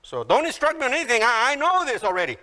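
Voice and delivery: high, fast mock impatience; dismissive know-it-all. Martha to Jesus, high-pitched impatient dismissal. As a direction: rush to shut down the instruction. Acting Martha refusing instruction.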